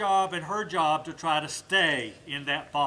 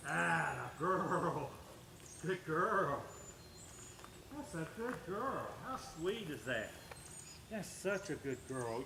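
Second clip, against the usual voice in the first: soft, low praise for the dog; hushed sweet-talk to the dog; 3 semitones lower, 9 dB quieter than usual. Real, not acted. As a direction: coo praise gently to a good dog.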